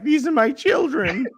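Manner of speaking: high, slow, doting cat lady voice; crazy cat owner. Retold or imitated. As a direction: coo it like a cat obsessive. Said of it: "crazy cat owner that's like".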